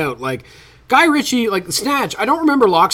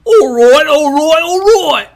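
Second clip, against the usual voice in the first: much higher and louder, slow hammed-up Texan swagger; mock Matthew McConaughey drawl; 10 semitones higher, 9 dB louder, much slower than usual. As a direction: ham up a cocky movie-star Texan drawl, pitch climbing on each all right. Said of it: "trying to do a fucking English accent, and he'd be like".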